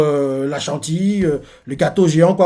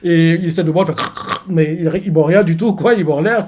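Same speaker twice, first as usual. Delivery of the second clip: ordinary talk, nothing marked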